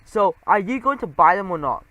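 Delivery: loud impatient demand; shrieking. Acted. The sales mouse demands Geronimo pay for the stuck boots, a loud shriek. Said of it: "The sales mouse shrieked".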